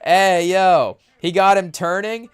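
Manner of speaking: usual speaking voice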